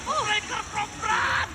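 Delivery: high, slow drawn-out announcer shout; fighting game announcer. Real, not acted. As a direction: boom it out like an arena announcer. Play-by-play kill call.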